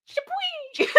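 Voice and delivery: very high squealed sound effect; high-pitched splash mimicry; mimicked splash squeal.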